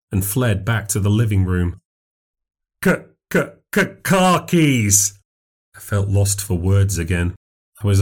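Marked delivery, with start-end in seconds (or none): 2.8-5.2 stuttering. Acted. Stranger urges the narrator for his car keys, stuttering. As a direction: force out the stuck k sounds.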